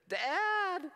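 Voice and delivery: put-on high, loud child's cry of Dad!. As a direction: call out like a hurt little boy running to his father. Injured son running to dad.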